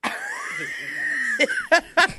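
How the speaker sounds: high shrieking burst of laughter; real high-pitched shriek of laughter. Real, not acted. Wild laugh at Disney joke.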